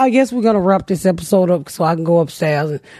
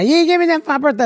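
higher, fast complaining whine; mock whine; 8 semitones higher, much faster than usual. Quisha complaining about her birthday, higher and fast. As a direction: whine like you were left out. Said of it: "she didn't think".

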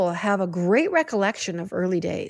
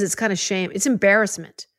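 ordinary delivery

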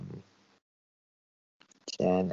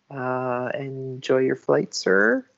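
polite attendant voice; 6 semitones higher, slower than usual. Acted. Attendant, higher.

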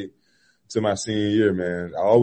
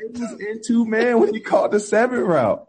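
laughing; 11 semitones higher than usual. Real, not acted. Laughing over each other.

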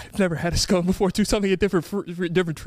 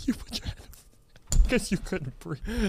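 laughing; 5 dB quieter, slower than usual. Real, not acted.

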